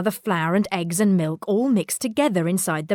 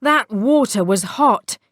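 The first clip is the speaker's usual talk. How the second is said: plain reading voice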